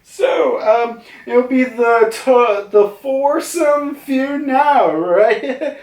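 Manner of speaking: high-pitched, put-on pleading; hammed-up pitiful cartoon voice; sad Zeus. Zeus greets Mama and the new member, pitiful. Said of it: "so sad about this".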